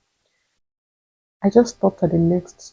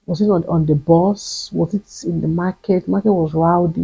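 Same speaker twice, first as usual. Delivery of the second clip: ordinary delivery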